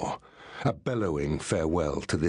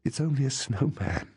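much higher, lightly gasped relief; understated surprise; 8 semitones higher than usual. A narrator's reading. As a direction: say it with a small gasp, pitch raised. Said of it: "she gasped".

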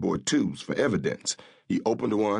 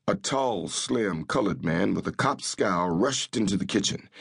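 usual speaking voice

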